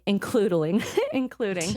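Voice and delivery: laughing through the word as she stumbles; a real laugh breaking into the reading. Real, not acted. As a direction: giggle it out after tripping over the word.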